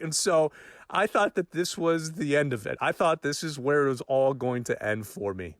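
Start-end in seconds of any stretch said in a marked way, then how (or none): none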